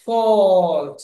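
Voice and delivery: loud ফল্ট with a long "aw"; aw sound demo. Shown as a demonstration. Teacher models the au spelling, ফল্ট as loud drawn-out "fawwlt". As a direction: stretch ফল্ট as "fawwlt", falling. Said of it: "এ ইউটা অ উচ্চারণ হচ্ছে".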